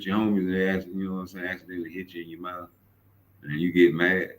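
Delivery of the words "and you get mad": ordinary talk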